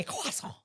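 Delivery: exaggerated "croissant" as kwa-son; mock French pronunciation. Shown as a demonstration. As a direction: say "croissant" in put-on French, t silent. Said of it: "You don't have to say".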